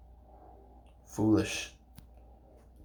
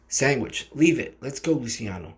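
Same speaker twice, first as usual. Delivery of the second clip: commanding shout; 5 semitones higher, 6 dB louder than usual. Acted. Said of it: "Hernandez directed".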